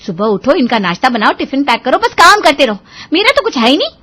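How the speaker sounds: building morning nag; nagging. Acted. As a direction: complain through your list of chores. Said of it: "श्रुति की चिक चिक चिक चिक चालू".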